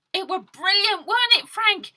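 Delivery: high, loud thrilled lad; high, loud gleeful boy; excited boy. Acted. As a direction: squeal it like a delighted kid. Robert's excited quote.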